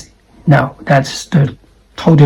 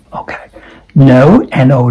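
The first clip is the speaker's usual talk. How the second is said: ordinary talk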